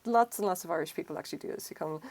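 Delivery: ordinary talk, nothing marked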